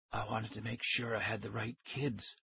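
very soft, whispery man's voice; thin whisper. A narrator's reading. Stranger reassuring captured kids, thin whisper. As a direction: speak in a thin, hushed voice. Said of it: "He had a thin whisper of a voice".

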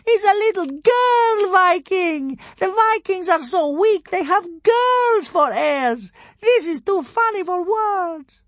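very high, slow, drawn-out mockery; jeering cry. A narrator's reading. Roman crowd ridicules the Viking heirs, crying out slowly. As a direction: crow loudly over the silly sight. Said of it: "they cried".

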